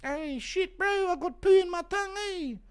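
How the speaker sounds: high panicked Kiwi bro; Kai Kara France impression. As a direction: squeal it like a grossed-out bro. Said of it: "Impression of Kai Kara France fecal vomiting during the haka".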